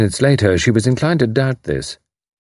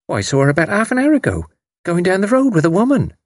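high-pitched recollecting servant voice; servant girl; 11 semitones higher, faster than usual. A narrator's reading. Servant tells the mother what she saw, high and animated. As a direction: tell it in a high voice as it comes back. Servant recalls seeing the child.

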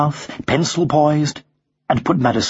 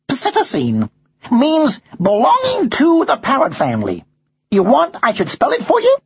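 put-on high parrot voice, American accent; 12 semitones higher than usual. Parrot giving the father the crossword answer, high American voice. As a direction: explain it as a know-it-all bird.

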